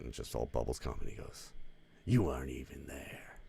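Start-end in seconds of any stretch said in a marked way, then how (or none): 2.0-3.4 breathy hushed whisper of a retold line; imitated whisper. Brando quipping from the bathtub, whispered.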